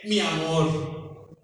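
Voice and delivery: slow, lingering mi amor; tender endearment. Retold or imitated. Ana teaching the pastor to say mi amor to his wife, slow and tender.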